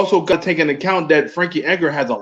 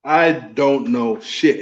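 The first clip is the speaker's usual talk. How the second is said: ordinary talk, nothing marked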